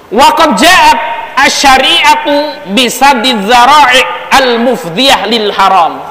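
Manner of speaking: measured kitab reading; Arabic text recitation. Acted. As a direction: recite the classical text slowly.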